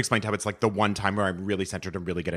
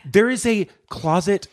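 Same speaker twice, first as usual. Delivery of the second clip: amazed; 10 semitones higher, slower than usual. Real, not acted. Exaggerated amazement.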